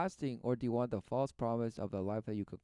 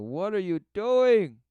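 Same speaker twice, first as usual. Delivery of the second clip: high, loud, slow cry of alarm; the spirit calling out; 9 semitones higher, 12 dB louder, slower than usual. Acted. Commentator voicing the inner spirit crying out, high and loud. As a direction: call out like a startled inner spirit. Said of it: "And the spirit within you saying".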